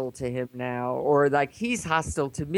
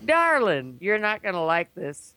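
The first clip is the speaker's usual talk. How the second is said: put-on Southern accent; 3 semitones higher than usual. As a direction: break bad news in a Southern accent. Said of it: "Now he's from Little Rock. It's got a Southern accent".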